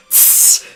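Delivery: sharp hissing like a cat. Acted. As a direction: hiss through the teeth like an angry cat. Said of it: "the cat hisses".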